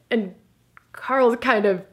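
ordinary talk

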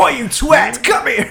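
a hammed-up British geezer threat, giggling; Jason Statham impression. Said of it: "Imagine Jason Statham".

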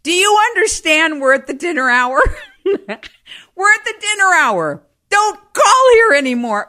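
very high, loud scolding through laughter; furious mother on phone. Retold or imitated. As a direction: chew out the caller at dinnertime. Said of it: "She would say".

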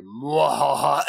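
low hammed-up villain cackle; evil laugh. Hooded villain reveal.

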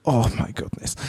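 low, breathy mock dismay; quiet exasperation. Imitating a harried character faced with chaos, quiet exasperated sigh. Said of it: "she was increasingly sort of exasperated".

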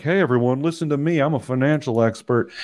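mock pundit. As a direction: puff up like a know-it-all on TV. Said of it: "I'm like".

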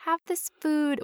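put-on soft, high parent voice soothing a child; imitated comforting parent; coaxing parent. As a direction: offer it gently, high and soft.

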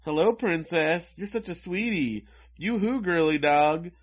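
high, drawn-out teasing calls; mock catcalling yell. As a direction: taunt in a sing-song falsely sweet call. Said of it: "the boys are yelling out".

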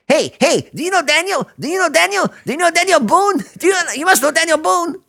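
very high, loud, teasing stranger's voice; spring the joke with a grin, pushing the pitch way up; gleeful stranger. Retold or imitated. Grinning stranger quizzes again.

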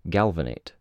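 ordinary delivery, nothing marked

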